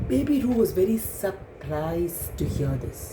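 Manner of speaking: ordinary delivery, nothing marked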